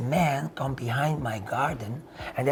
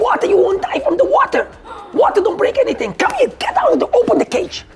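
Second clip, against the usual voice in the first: high, loud, insistent tirade; worked-up shouting; 6 dB louder than usual. Retold or imitated. Washer demanding homeowner open the cage, high shouting. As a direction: holler the demands with mounting pitch.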